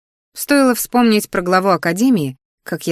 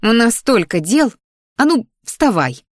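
higher, slow emphatic scolding; indignant; 5 semitones higher, 4 dB louder than usual. A narrator's reading. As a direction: lean on each word to get a sleeper up. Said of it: "возмутилась она".

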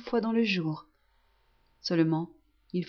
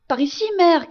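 higher, louder shout to guide her; crying out; 8 semitones higher, 7 dB louder than usual. Acted. Son calls his blind mother toward his bed, shout. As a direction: call her over to your side. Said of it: "crie le petit chose".

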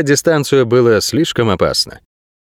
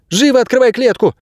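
high-pitched sharp command; urgent order; 13 semitones higher than usual. A narrator's reading. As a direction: order them about at gunpoint, voice high. Armed girl ordering a man.

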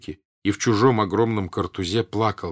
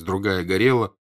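ordinary delivery, nothing marked

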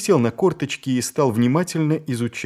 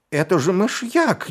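high bewildered exhale; shocked disbelief; breathless baffled cry; stunned gasp; 9 semitones higher than usual. A narrator's reading. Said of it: "недоуменно выдохнул Боггис".

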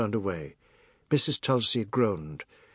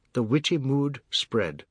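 ordinary delivery

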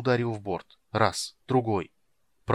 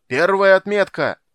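high, louder urgent depth call; shouting; 9 semitones higher, 11 dB louder than usual. A narrator's reading. Sailor repeats depth warning to captain, urgent shouting. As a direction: yell the warning at full voice. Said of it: "крикнул матрос с лотом".

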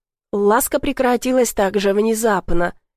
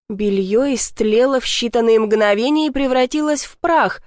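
plain reading voice

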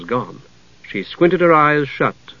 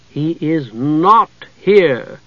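stern and cold; 3 semitones higher, much slower than usual. A narrator's reading. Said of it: "in stern, cold tones".

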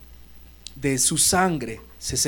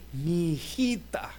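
tender little daughter; 5 dB quieter, much slower than usual. Retold or imitated. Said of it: "como expresando".

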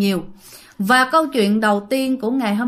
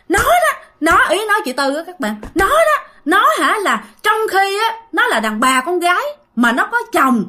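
high-pitched retold tirade, jumping up on each accusation; scolding; 6 semitones higher than usual. Possessed sister rails about her sibling drinking, scolding in a higher pitch. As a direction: imitate a nagging, indignant telling-off. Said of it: "mà lại chửi, chửi um sùm hết".

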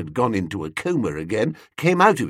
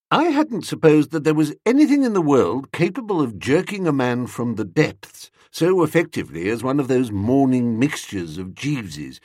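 ordinary delivery, nothing marked

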